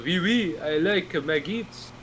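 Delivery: slow, lightly put-on French accent; mock French accent. Friend mocks Frenchmen eating maggots in a light French accent. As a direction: drawl it like a cartoon Frenchman. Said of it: "They go".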